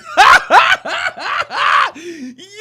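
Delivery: loud, high cackling laugh; hysterical laugh. Real, not acted. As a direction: burst out in a long, high belly laugh. Cracking up at video clip.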